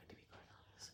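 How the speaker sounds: unvoiced whisper under her breath; whispered aside. Real, not acted. Recapping the reunion, whispering her true opinion.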